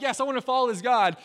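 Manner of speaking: loud, high burst of eagerness; excited declaration. Retold or imitated. People who just saw God work, excitedly pledging to follow. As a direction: imitate people swept up in excitement. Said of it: "temporary moment of excitement".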